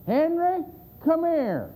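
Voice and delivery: high-pitched holler to summon someone; calling out. Retold or imitated. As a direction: imitate a wife calling her husband over. Lady calls her husband over.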